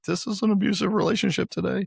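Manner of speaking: comically squeaky, very high voice; retold protest in a pushed-up squeak; mock high-pitched complaint. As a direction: whine it way up high, as a joke. Retold complaint after getting hurt.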